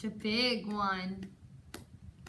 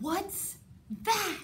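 surprised question; 8 semitones higher than usual. Acted. Spotting something in cave.

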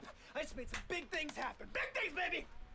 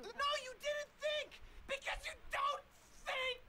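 higher, slow, hammering yell at his partner; furious yelling; slower than usual. Acted. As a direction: shout each word down at him. Berating partner in a fight.

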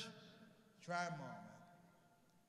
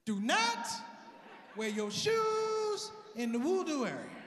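higher, loud, slow bellowed command; sudden shout; 8 semitones higher, 9 dB louder, slower than usual. Pretend. Guard shouting the rule at the comic, loud and slow. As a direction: explode into a stern bark.

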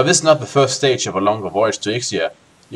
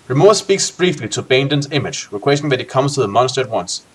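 ordinary talk

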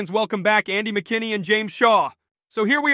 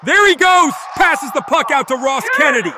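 excited shouting; 6 semitones higher than usual. Real, not acted. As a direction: shout over the crowd noise. Excited replay commentary.

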